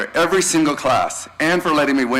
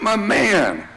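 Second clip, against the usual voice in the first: mock shout-out; 10 semitones higher than usual. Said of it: "He never didn't shout me out".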